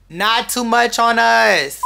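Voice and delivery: loud, high joking boast; mock boast. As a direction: belt it out as a playful brag. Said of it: "No, I'm joking".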